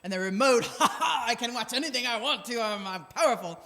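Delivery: hammed-up power-hungry man, bursting into a gloating laugh; mock macho guy. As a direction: swagger through it like a guy hogging the remote.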